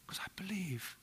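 breathy confiding whisper. Real, not acted. Pastor confides his faith to the congregation, whispering. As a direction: let it drop to a whisper, as a secret. Confession about tithing from savings.

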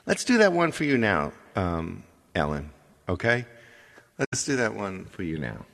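ordinary talk, nothing marked

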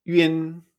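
"yun" as ü-in; Y pronunciation. Shown as a demonstration. Teacher modelling Y plus the final, "yun" as ü-in. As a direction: say "yun" with rounded ü gliding to in. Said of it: "y con la Y".

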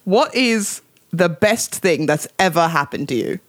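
slow, posh British question; deliberate put-on British accent. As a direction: read it slowly in mock-British. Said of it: "read it with a British accent".

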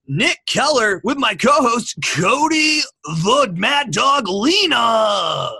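slow, booming ring announcer intro; fighting game announcer. Acted. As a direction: stretch the nickname out like a fight intro. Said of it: "Your sports announcer voice".

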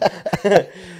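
high-pitched real giggling; helpless laugh. Real, not acted. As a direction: burst out laughing at a rude joke. Cracking up at an innuendo.

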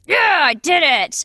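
high, cheering to yourself; self-congratulating. Retold or imitated. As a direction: celebrate like winning a game.

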